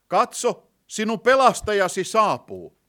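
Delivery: loud, high-pitched herald's announcement; ringing proclamation. Real, not acted. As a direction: proclaim it out to the whole congregation. Preacher repeats verse as proclamation.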